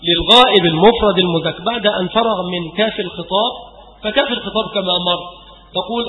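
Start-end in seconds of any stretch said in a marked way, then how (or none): none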